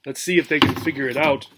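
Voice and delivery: ordinary talk, nothing marked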